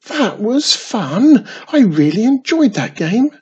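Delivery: beam with joy; delighted. A narrator's reading. Witch telling the tree how fun it was.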